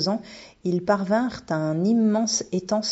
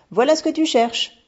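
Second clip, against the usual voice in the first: triomphant, voix plus haute et plus forte; 9 semitones higher, 5 dB louder than usual. A narrator's reading. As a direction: annoncer sa trouvaille avec fierté. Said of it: "un air triomphant". (la bibliothécaire rapporte le livre, triomphante)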